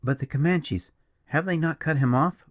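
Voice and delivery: usual speaking voice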